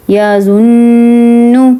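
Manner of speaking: یظن very slow, the ن nasal and held; nasal ghunna. Shown as a demonstration. Teacher reading fluently with ghunna. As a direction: draw out the ن of یظن through the nose. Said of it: "روانی سے پڑھ کے دکھاتی ہوں".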